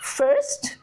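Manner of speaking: ordinary talk, nothing marked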